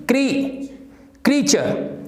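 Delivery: "क्रीचर" as KREE-chuh, silent r; correct pronunciation. Shown as a demonstration. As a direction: sound out "क्री", then "क्रीचर". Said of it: "this is".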